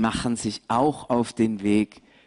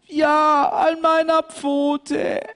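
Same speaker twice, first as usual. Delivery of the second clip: high, whimpering lamb, slow; a small lamb showing her sore paw; hurt lamb; 5 dB louder, slower than usual. Acted. Mariechen tells the shepherd about her paw, high and whiny.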